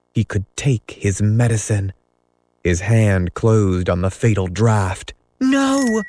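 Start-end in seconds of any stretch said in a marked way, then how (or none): 5.4-6.0 very high shrieked no; tiny fairy shrieking a warning. A narrator's reading. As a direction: scream to stop him. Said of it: "shrieked Tinker Bell".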